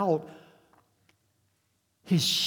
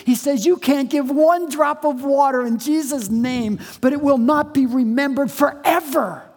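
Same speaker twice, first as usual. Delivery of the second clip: louder, higher pulpit voice; full-force preaching; 6 semitones higher, 8 dB louder than usual. Real, not acted. Pastor assuring his congregation of reward, forceful preaching. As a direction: proclaim it to the whole room.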